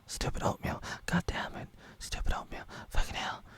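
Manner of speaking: whispered, breathless mockery of Flopper. Vlogger mocking Flopper's rant, whispered. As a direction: hiss it under your breath, mocking.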